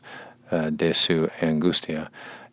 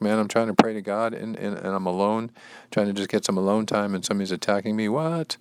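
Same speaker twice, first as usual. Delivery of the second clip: higher-pitched put-upon whine, rising to "What?"; exasperated complaint; 4 semitones higher than usual. Acted. Reader as Jacob attacked while praying alone, exasperated complaint. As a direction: grumble in disbelief, voice climbing at the end. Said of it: "He's like".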